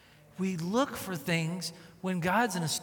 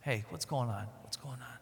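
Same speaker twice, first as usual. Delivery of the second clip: gentle Spirit voice; 6 semitones lower, 8 dB quieter than usual. Acted. Remember how he speaks to us.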